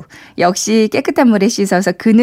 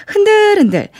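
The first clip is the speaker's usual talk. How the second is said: playful high; 11 semitones higher than usual. Shown as a demonstration. Demonstrating shaking the bag.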